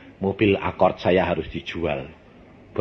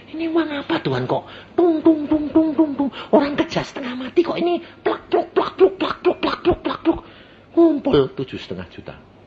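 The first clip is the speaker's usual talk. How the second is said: much higher, louder, astonished; amazed exclamation; 5 dB louder than usual. Retold or imitated. Preacher retelling his amazed prayer to God, high and excited. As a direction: gush in wonder at the money.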